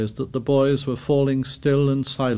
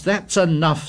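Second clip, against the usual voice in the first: sharp; 6 semitones higher, 4 dB louder than usual. A narrator's reading. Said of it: "said Ralph sharply".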